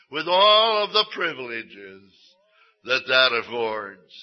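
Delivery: loud, ringing pulpit proclamation; fervent preaching. Acted. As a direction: preach it out with full conviction. Preaching opening on adoption as sons.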